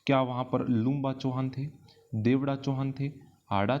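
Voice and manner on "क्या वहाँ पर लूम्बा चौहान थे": ordinary talk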